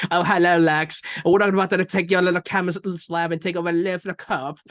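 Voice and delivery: loud, hammed-up fake British; mock British accent. Mocking Victoria's dad on the show, awful British. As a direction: overdo a terrible British accent. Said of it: "trying to fucking make fun of the British accent".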